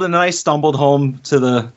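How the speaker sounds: ordinary talk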